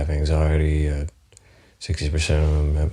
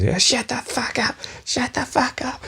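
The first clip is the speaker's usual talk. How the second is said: high, strained mock mum yelling at her kids; screaming mum; 4 dB quieter than usual. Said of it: "a mum screaming at her kids".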